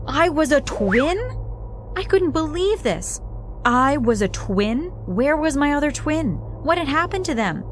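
high-pitched, incredulous questioning; stunned disbelief. A narrator's reading. Child discovering a secret twin in a photo, stunned and incredulous. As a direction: reel from a shock discovery, pitch jumping up.

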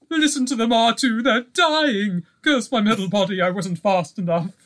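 high, fussy, fretful robot butler voice; C-3PO impression. Host tries a second impression for his co-host, high fretful droid voice. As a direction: panic like a prissy golden droid. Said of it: "this will be a different one".

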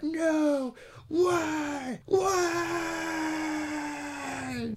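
high, wailing cry of why that swells into a shout; hammed-up anguished howl at the sky; dramatic whys. Hermit mourning his dog, overacted wailing shout. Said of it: "those dramatic whys".

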